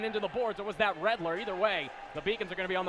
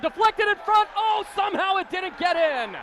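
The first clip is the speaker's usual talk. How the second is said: excited play-by-play; 10 semitones higher, 6 dB louder than usual. Real, not acted. Near goal in front.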